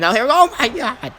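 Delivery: high, loud "oh my god" at the big lift; excited shout. Real, not acted. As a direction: yell out in real amazement. Huge lift on Strowman.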